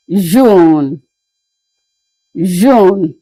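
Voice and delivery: June said zhoon, French style; French pronunciation. Shown as a demonstration. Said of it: "it is pronounced as June".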